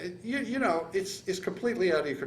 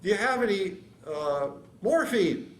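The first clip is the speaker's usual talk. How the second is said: higher, louder call for help; retold yell; 6 semitones higher, 4 dB louder than usual. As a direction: re-enact calling out over noise. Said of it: "I yell at him".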